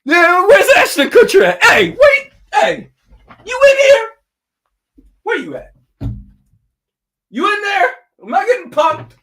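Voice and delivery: high, loud, calling out through laughter; laughing disbelief. Real, not acted. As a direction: yell for the hidden prankster. Thinks the pick is a prank.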